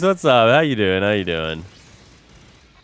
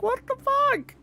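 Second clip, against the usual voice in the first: disbelief; 3 dB quieter, slower than usual. Retold or imitated. Retelling his disbelief at 2 am.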